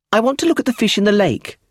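put-on high, loud little-girl voice; insistent child. A narrator's reading. Josephine to her mother, wanting the fish, insistent child. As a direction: pipe up high, like a child who wants it now.

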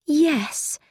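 one restrained word that cuts; understated knife-edge yes; cutting yes. A narrator's reading. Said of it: "like a knife".

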